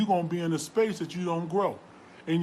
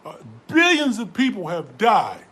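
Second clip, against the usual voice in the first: usual speaking voice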